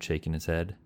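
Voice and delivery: ordinary delivery, nothing marked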